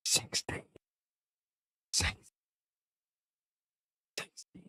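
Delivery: breathy whispered sixteen, fading away. Shown as a demonstration. Gamer whispers the final word to the Atlas, whispering. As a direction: whisper Sixteen, then let it die out. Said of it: "I'm gonna whisper sixteen".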